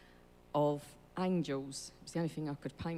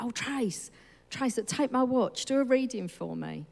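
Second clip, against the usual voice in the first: higher, louder mock pleading; imitated eager request; 7 semitones higher, 6 dB louder than usual. Friend asking Trace for a reading, imitated eagerly. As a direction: pester as the eager friend, pitched up.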